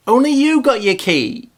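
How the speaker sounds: ordinary talk, nothing marked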